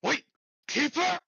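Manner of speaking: high, loud call after someone; desperate shout. Acted. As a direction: yell it as they vanish. Hades calls after the departing Keeper.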